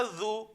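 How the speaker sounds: "ذو" with a soft voiced TH, as dhoo; drawn-out "ذو" with thin TH, no Z; thin dhal. Shown as a demonstration. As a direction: say "ذو" slowly, tongue between teeth. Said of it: "ذال جو ہوتا ہے وہ باریک ہوتا ہے".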